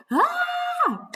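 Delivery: very high loud shout; pounce roar. Acted. Wolf pouncing at Little Red, high shout. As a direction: let out a big pounce yell.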